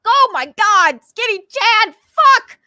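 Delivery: high, loud, exasperated yell; real annoyed shriek of a curse; frustrated outburst. Real, not acted. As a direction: blow up at the name in real frustration. Exasperated outburst over a rude comment.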